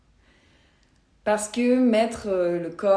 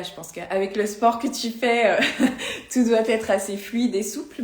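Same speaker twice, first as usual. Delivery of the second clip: amused, laughing; 4 dB louder than usual. Real, not acted. Coach kidding a sporty live viewer, amused and laughing. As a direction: chuckle through a friendly remark.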